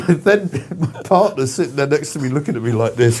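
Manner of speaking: ordinary talk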